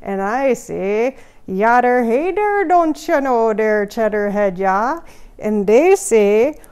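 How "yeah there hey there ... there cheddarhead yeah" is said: put-on Wisconsin accent. Lumberjack answering neighbours in a loud Wisconsin accent. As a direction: belt the Wisconsin greeting back. Repeating the accent bit louder.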